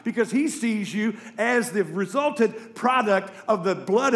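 loud, high, driving sermon delivery; full force preaching. Acted. Preacher driving the point home to the congregation, full-force preaching.

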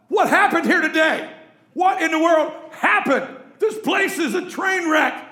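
loud, high, disbelieving shout; bewildered yell. Retold or imitated. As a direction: shout it like a husband walking into chaos. Bewildered husband yelling at mess.

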